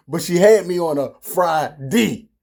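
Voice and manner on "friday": "Friday" ending in "dee"; Baltimore-style "Friday", -day turned to -dee; Baltimore pronunciation. Shown as a demonstration. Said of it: "That's how we say it in Baltimore".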